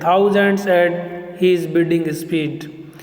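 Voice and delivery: slow, measured reading of the verse line; poem line read slowly to the class; slow recitation. Shown as a demonstration. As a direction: recite it slowly, weighing each word. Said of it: "The phrase that is written as".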